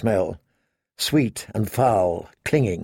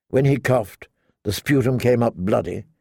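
plain reading voice

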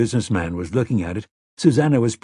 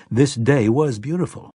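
usual speaking voice

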